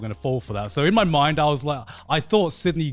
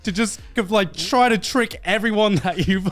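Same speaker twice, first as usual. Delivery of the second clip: disbelief; 5 semitones higher than usual. Retold or imitated. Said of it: "And I was just like".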